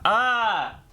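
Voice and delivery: high, drawn-out "ah" of panic; frustrated cry. Real, not acted. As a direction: let out a high cry of real distress. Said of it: "trying not to freak out".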